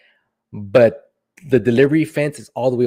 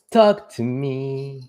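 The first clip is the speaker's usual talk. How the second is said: very slow, drawn-out plea; slow coaxing; 3 semitones higher, much slower than usual. Shown as a demonstration. Streamer coaxing silent viewers, drawn out slowly. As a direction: stretch out each word to coax them.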